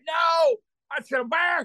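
high loud incredulous cry; shocked disbelief. Retold or imitated. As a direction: balk at being booked. Disbelief at bear match.

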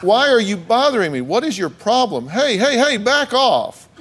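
high, hammed-up protest; mock exasperated. Host reading husband's excuses, mock exasperated. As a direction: act out fending someone off, pitch climbing.

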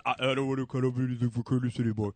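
low mumbled imitation of a coworker; Phil impersonation. Producer quoting his coworker's refusal to the host, mocking impersonation. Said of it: "That's a good impersonation".

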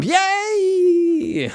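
very high cheering squeal; shrill falsetto cheer; excited squeal. Acted. Cheering after the ad.

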